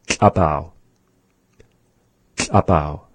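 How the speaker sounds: "Klapau" repeated clearly; language example. Shown as a demonstration. Teacher repeating "Klapau" for learners. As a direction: say "Klapau" plainly, falling at the end. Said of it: "Klapau is saying they went".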